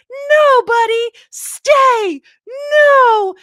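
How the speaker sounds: high, loud, drawn-out acted shouts; imitated yelling. Boy on a boat yells at his dog to leave, acted yelling. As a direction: yell as the boy sending his dog away. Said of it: "he's, like, yelling at the dog".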